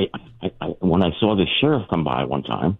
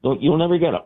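high, offhand lawman repeating himself; sheriff voice; 13 semitones higher than usual. Retold or imitated. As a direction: toss it off like it's nothing. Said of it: "He goes".

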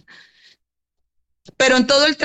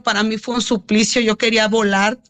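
ordinary talk

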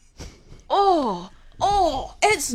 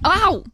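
sudden loud ow of pain; sharp pain yelp; 5 dB louder than usual. Real, not acted. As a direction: yelp as the shock hits. TENS contraction simulation.

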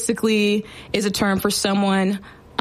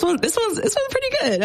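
excited squeal; 14 semitones higher than usual. Real, not acted.

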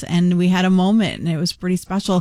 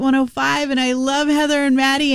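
higher, gushing imitation of a fan; 8 semitones higher than usual. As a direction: play the star-struck listener pouring it out. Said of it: "And she said".

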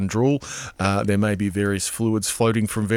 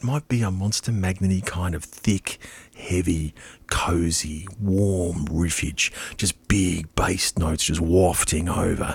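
low, drawn-out, relishing every word; slow savouring; 5 semitones lower, 4 dB quieter, much slower than usual. Real, not acted. Host sets up a Monster Magnet evening, slow savouring. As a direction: sink slowly into the words, low and softened. Savouring heavy cozy music mood.

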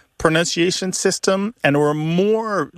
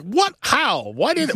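high-pitched mock disbelief; acted bafflement; 10 semitones higher than usual. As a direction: imitate baffled listeners, voice leaping high on each question. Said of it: "which people are like".

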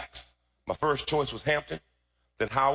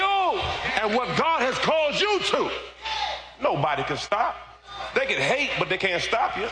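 high, drawn-out preaching shout; full-force preaching; 11 semitones higher, slower than usual. Real, not acted. Preacher declaring God's backing to the church, full-force shout. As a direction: belt it out slowly to the congregation.

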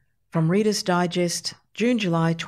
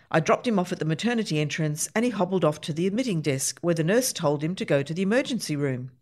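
plain reading voice

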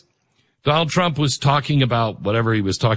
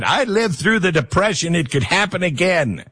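lightly voiced insistent grandmother, higher pitch; grandmother's retort; 6 semitones higher than usual. Grandmother's imagined earnest retort.

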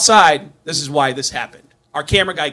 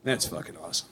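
hushed, low murmur of delight; awed whisper; 5 semitones lower, 15 dB quieter, faster than usual. Retold or imitated. Fan hearing Gene banned him, awed whisper. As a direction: whisper it in mock awe. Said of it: "I heard that and I just like".

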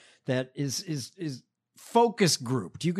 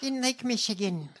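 high-pitched caricature politician voice; Haley Stevens impression; 6 semitones higher than usual. Co-host parodying candidate's Lake Michigan anecdote, high caricature. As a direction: finish the parody in a high put-on voice. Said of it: "If Lily Tomlin would have done this".

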